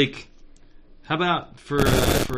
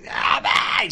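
silent scream attempt; 4 dB louder than usual. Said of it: "She's, like, trying to scream".

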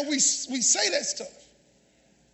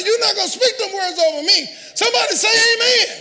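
higher, louder, forceful pulpit delivery; full-force preaching; 6 semitones higher, 5 dB louder than usual. Acted. Preacher defending someone from others' spoken words, full-force preaching. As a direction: preach it hard, calling for amens.

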